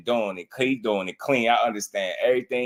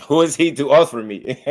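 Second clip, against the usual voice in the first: higher, louder mock Mexican accent; Canelo accent impression; 5 semitones higher, 6 dB louder than usual. Streamer mimicking the clip he just played, raised Mexican accent. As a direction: playfully parrot the boxer's indignant accented question. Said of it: "listen to him".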